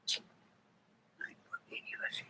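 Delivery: breathy whisper to a pet. Real, not acted. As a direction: whisper it softly to the cat. Whispering to cat.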